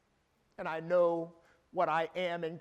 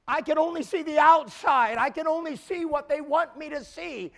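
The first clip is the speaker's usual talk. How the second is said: higher, louder rising sermon cadence; preaching at full force; 7 semitones higher, 7 dB louder than usual. Acted. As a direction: drive the point home from the pulpit. Preacher builds intensity at pulpit.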